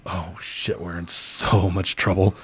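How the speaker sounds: hushed, scared mutter; dread. Retold or imitated. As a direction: whisper it like you're caught. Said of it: "we're all like".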